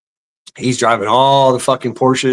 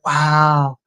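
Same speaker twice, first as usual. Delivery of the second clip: mock amazement; 4 semitones higher, much slower than usual. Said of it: "you're like".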